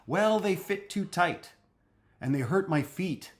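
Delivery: mock child voice, a touch plaintive. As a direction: play the kid explaining sore feet. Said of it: "child answers in voiced role".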